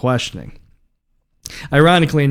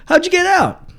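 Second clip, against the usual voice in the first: surprised friend; 10 semitones higher than usual. Retold or imitated. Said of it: "people come up to me being like".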